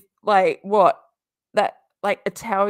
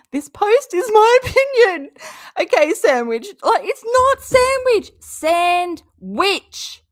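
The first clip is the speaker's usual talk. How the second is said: much higher, louder, squeaky disbelief; mock her name in disbelief; incredulous mockery; 11 semitones higher, 8 dB louder than usual. Mocking Katie's sign-off name, high and loud.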